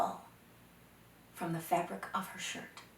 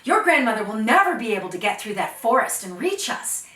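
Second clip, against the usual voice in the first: confident boast; 2 semitones higher, 13 dB louder than usual. Acted. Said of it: "Oh, Dakota laughed and he said".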